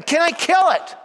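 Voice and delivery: high, pressing angel asking a third time; eager angel. Retold or imitated. Priest's angel pleads with the man to let it kill. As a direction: push the question up high and insistent.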